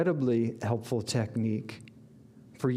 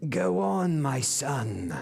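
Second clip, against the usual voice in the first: put-on English accent; 3 semitones higher, slower than usual. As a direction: call it like an English fan urging on a player. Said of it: "I'm gonna butcher the English accent".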